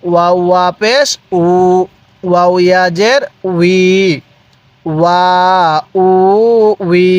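measured spelling chant, holding "উ" long; lengthened level vowel on each of "ওয়া, উ, উই"; drawn-out vowels. Shown as a demonstration. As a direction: spell it, then stretch "ওয়া, উ, উই" one by one. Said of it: "কিভাবে পড়ব?".

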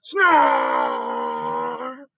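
very low rumbling snore; deep snore. Acted. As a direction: snore like a huge elephant.

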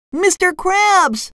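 very high squeaky cartoon cry; SpongeBob. A narrator's reading. Fry cook surrounded by mob sees his boss, crying out. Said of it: "SpongeBob cried".

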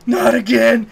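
a light hammed-up "not again"; mock dismay. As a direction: play John Hurt's weary dread, lightly voiced. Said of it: "he goes".

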